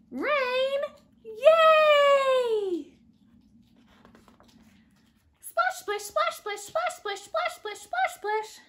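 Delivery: high, playful worm voices; excited little worms. Acted. Worms splashing happily in the rain. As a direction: squeal with delight as tiny worms.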